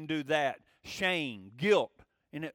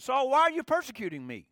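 raised, higher, louder call to Saul; voice of Jesus calling out; 5 semitones higher, 6 dB louder than usual. Retold or imitated. Jesus calls Saul on the road to Damascus, loud and high. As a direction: ring it out like a voice from heaven.

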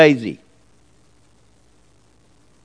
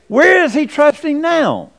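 mocking critic; 7 semitones higher than usual. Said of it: "They say".

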